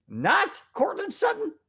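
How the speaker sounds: high, incredulous repeat of the name; can't believe the name is missing; shocked disbelief. Real, not acted. Fan reading the roster, shocked, high and incredulous. Shocked at Pro Bowl snub.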